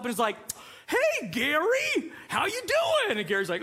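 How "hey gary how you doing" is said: very high, drawn-out cartoon greeting; squeaky mock cartoon host; cartoon character. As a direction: imitate a chirpy little cartoon. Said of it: "this little cartoon pops up".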